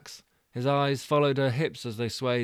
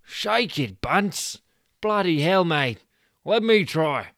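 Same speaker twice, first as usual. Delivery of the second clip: higher, louder yelling; frantic shout; 6 semitones higher, 7 dB louder, slower than usual. Acted. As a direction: cheer and grab the chance. Stu cheers then tries.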